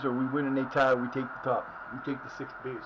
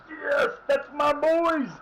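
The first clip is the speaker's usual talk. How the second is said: joyful yell; 13 semitones higher, much slower than usual. Real, not acted. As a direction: scream it out in triumph. Celebrating goal, crying out.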